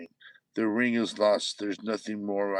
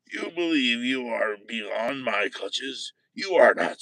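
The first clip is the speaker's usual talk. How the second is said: line read again with menace, the last words louder; you believe you are beyond my clutches, rising to a loud are; 1 menacing retake; 3 semitones higher, 7 dB louder than usual. Shown as a demonstration. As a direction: throw out the threat, punching are.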